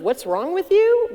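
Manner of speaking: a hint of accusing what's wrong with you; lightly mock the blame; mild reproach behind the words; blaming tone. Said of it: "with a slight tone of".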